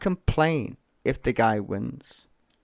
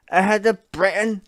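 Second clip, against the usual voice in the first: louder, higher sneering repeat; mocking echo; 8 semitones higher, 9 dB louder than usual. Streamer throwing the politician's phrase back, loud mock.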